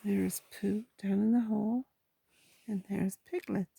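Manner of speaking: hushed whisper to a listener; whispered aside. Real, not acted. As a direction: whisper while showing the picture. Whispered asides over the pictures.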